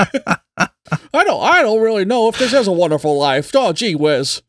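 put-on high, halting old-timer voice; Jimmy Stewart impression. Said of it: "a Jimmy Stewart impression".

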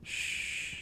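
soft shushing; hushing whisper. Acted. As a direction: hush them so she sleeps. Hushing so she can sleep.

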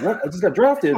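surprised. Retold or imitated. Retelling surprise at draft news.

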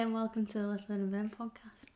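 soft, trailing into a whisper; soft intro read. Shown as a demonstration. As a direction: ease into a hushed intro. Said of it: "You have to say".

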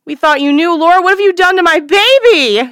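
a mother's voice breaking into a shriek; anguished cry. Acted. Mother screaming at her daughter over her lost baby, anguished shriek.

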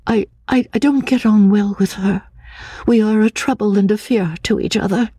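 put-on high, hesitant, frightened girl's voice; a nervous fragile woman, stammering; lightly breathy, fearful young woman; timid young woman. A narrator's reading. Anne's timid voice again.

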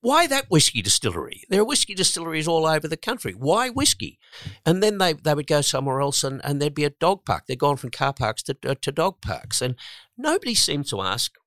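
high, loud, exasperated questioning; frustrated. Real, not acted. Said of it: "really frustrates me".

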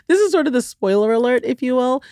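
amused, smiling delivery. Real, not acted. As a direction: grin through the line. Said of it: "hear the smile in my voice".

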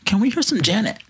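high-pitched imitation of a girl asking; girl sweet-talking. Second girl asking the DJ, high girlish wheedle. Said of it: "second hottest girl in our group".

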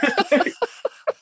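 high burst of laughter; short amused laugh. Real, not acted. Laughing at own outburst.

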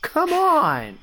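high, whiny exasperation; exasperated protest. Acted. Teen telling shapeshifter to copy Chad, exasperated. Player's character protests the impersonation.

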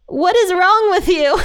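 incredulous, high-pitched mock scolding; exasperated Jesus. Jesus to his horrified disciples, playfully exasperated. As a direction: act out an exasperated "come on, guys". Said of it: "he's kinda like that".

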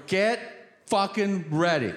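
pumped like before a game; amped up. Retold or imitated. Caller pumped up to the operator, loud hype. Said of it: "I'm so amped up".

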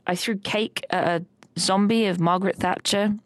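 ordinary delivery

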